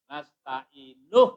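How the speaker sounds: slow "Nasta'inuh" with a loud final "nuh"; linking syllable. Shown as a demonstration. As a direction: stretch "Nasta'inuh" and punch the last syllable. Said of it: "kalau dari sini ke sini".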